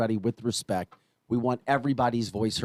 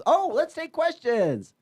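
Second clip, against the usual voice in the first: high, slow, airy mock offhand voice; mock casual whim; 13 semitones higher, slower than usual. Host mocks the idea of taking questions on a whim, airy and high. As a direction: play the breezy careless handler. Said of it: "It isn't just a spur".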